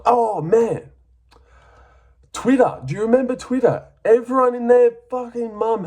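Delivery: high, loud-edged incredulous rant; worked-up exclamation. Real, not acted. Fan recalling the uproar on Twitter, worked up and high. Ranting about everyone's scoring online.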